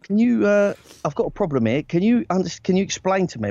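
timid, stumbling plea. Retold or imitated. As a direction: ask haltingly, nervous of confronting her. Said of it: "a bit too soft".

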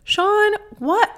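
sudden high-pitched why; exasperated at Sean's antics; exasperated high cry. Real, not acted. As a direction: squeal it in disbelief. Exasperated outburst.

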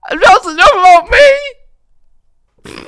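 high, slow shrieking laugh. Real, not acted. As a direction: drag it out squealing with laughter. Giggling fit, drawn out.

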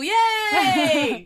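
big high cheer with a laugh; loud enthusiastic yay. Shown as a demonstration. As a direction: shout it out, full of joy. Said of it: "I didn't mean that to sound so feeble".

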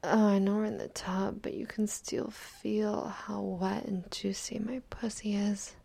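ordinary talk

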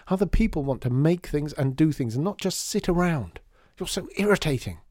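put-on a child lashing out in a rage; angry outburst. Lydia shouting at Artie, angry and hurt.

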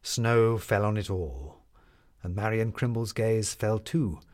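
usual speaking voice

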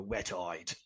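a sketchy, self-mocking attempt at an elderly patrician actor; patrician Michael Caine. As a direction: sink into a soft, teary old-gentleman voice. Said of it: "that was terrible".

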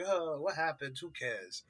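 soft, trailing-off shrug, lightly voiced actor; Al Pacino. Podcaster mimics actor brushing off a stupid mistake, quiet and dismissive. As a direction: toss it off quietly, dropping away. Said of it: "Al Pacino's like".

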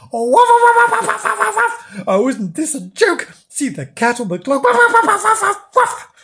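high, excited dog voice with rapid wuffs; mad barking dog. A narrator's reading. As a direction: bark like a delighted dog teasing a cat. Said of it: "barking madly".

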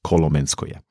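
Kolomenskoje in a South Slavic pronunciation, said low. Shown as a demonstration. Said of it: "das ist jetzt meine südslawische Aussprache".

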